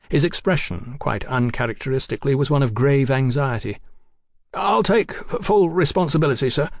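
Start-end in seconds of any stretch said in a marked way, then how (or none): 4.5-6.7 nervous stammer, pitched higher. A narrator's reading. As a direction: stumble over the words anxiously. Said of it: "he stammered".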